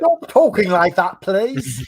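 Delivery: slow mock British accent. Mock-offended Brit after accent debate.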